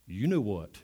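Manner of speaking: low, very soft parrot voice; hushed, knowing tease from a parrot; parrot's sly taunt. Acted. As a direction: drop it down for the punchline. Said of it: "Parrot looks at him and says".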